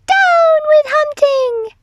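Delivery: very high, loud dinosaur hoot; high-pitched hooted protest. A narrator's reading. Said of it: "he hooted".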